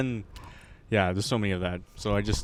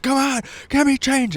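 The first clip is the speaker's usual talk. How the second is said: high-pitched imitation of a begging man; pleading beggar; 12 semitones higher than usual. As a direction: plead for spare change in a mock voice. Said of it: "He's like".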